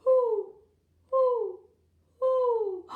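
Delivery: three loud, high owl hoots; big storybook owl hooting. Acted. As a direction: hoot it out like an owl, evenly spaced. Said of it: "an owl says".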